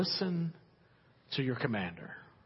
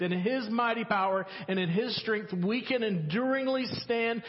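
rising sermon climax; 7 semitones higher than usual. Acted. Preacher rallying the congregation to stand, full-force preaching. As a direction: build it like a rallying cry to the church.

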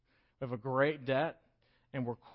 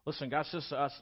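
ordinary delivery

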